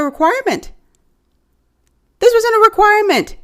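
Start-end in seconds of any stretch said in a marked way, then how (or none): 2.2-3.4 indignant. Real, not acted. Scolding viewer, indignant.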